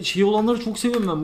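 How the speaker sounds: normal talking voice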